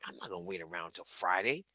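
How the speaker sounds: usual speaking voice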